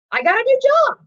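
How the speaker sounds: high, loud burst of elation; over-the-top excited upswing. Acted. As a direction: shout the good news, pitch soaring. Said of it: "But life does this".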